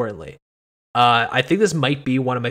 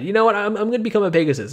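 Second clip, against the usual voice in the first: higher, airily sarcastic quote; mock breezy decision; 7 semitones higher, much faster than usual. Imagined woman choosing to become a Pegasus, mock breezy. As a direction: play someone casually deciding on a whim.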